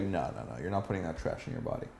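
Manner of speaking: low, quiet, quick mock refusal; mock-stern and soft, rattled off; playful command. Shown as a demonstration. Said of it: "something more playful".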